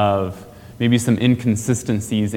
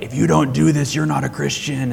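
legalistic author; 5 semitones higher than usual. Retold or imitated. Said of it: "they go on the law side where they're like".

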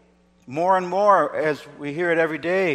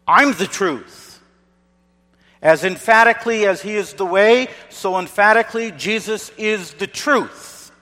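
full-force preaching; 3 semitones higher, 4 dB louder than usual. Acted. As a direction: thunder it from the pulpit.

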